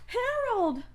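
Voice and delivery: high relieved call; relieved cry. Acted. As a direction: cry out his name in relief. Sister reunites with brother.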